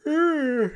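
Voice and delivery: loud yawn mid-sentence. Real, not acted. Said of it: "sorry for the yawning".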